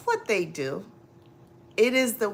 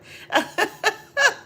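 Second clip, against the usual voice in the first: high laugh; 4 dB louder than usual. Real, not acted.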